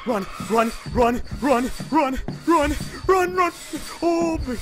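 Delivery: urgent panicked chant; being chased. Real, not acted. Fleeing monster.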